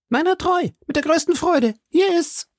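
put-on very high boyish voice, pleased; delighted stable boy. Stable boy hands over the paper for coins, high and delighted. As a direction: pipe up gladly like a lad.

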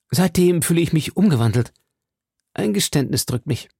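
weighed down by a confession; a hint of a troubled young man; D'Artagnan voiced.

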